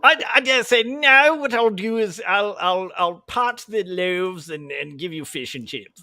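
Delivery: mock British, starting high and loud, easing down; hammed-up English accent, stretched out as it settles; English Jesus. Preaching loaves to the crowd as an English Jesus. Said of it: "English Jesus on the Sermon on the Mount".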